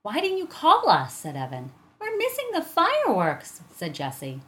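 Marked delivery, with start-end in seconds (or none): 0.0-1.1 high, loud, breathless protest; urgent complaint. A narrator's reading. Evan running to his mother, urgently complaining. Kids rush to their mother. 2.0-3.6 urgent whine. A narrator's reading. Jessie complaining to mother about the fireworks, urgent. Kids rush to their mother.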